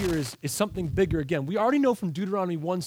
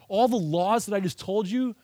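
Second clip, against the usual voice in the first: usual speaking voice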